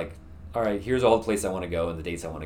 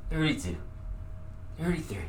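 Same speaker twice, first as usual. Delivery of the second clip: strained counting; 9 semitones higher than usual. Real, not acted. Straining push-ups.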